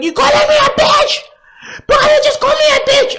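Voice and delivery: high, loud, shrieking outrage; hammed-up high indignant shriek; mock outraged sister. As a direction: scream it at the cop like the sister. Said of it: "She's like".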